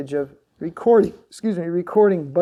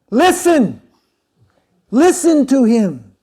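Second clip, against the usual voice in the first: much higher, louder shouted command, drawn out at the end; retold shout; 9 semitones higher, 13 dB louder, slower than usual. Guru telling devotees to listen to the master, loud urging shout. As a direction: urge them loudly to listen to the master. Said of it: "Babaji's little shouts".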